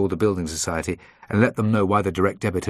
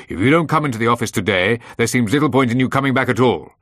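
loud, higher-pitched ultimatum; angry threat; 4 semitones higher, 7 dB louder than usual. A narrator's reading. Boss warning employee about losing his job, angry. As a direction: threaten forcefully with raised voice.

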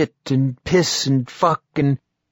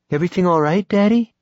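put-on higher, small girl's worried question; lightly voiced young girl; 8 semitones higher, 4 dB louder, faster than usual. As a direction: ask Daddy like an anxious kid. Young daughter asks her father.

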